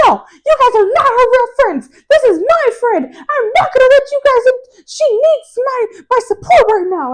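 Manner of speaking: very high, upset mock voice; upset friend. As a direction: imitate an upset friend in a high-pitched protest. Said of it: "Jordan got upset about something".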